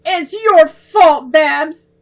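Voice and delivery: high, loud, slow accusation; accusing. Acted. Debbie blaming Babs for the ruined sketch, accusing. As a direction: blame her sister hard. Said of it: "Debbie accused".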